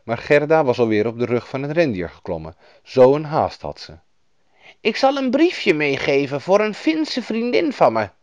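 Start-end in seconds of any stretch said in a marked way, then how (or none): 4.8-8.1 high, helpful woman's voice; kindly northern woman, pitched up; Lapland woman. A narrator's reading. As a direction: offer help as a storybook woman. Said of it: "zei de vrouw".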